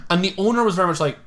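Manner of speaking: ordinary talk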